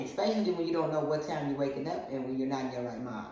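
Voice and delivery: ordinary talk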